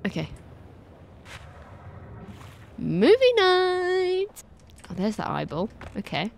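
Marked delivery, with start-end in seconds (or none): 2.8-4.4 drawn-out exclaim. Real, not acted. Gamer reads a screen title.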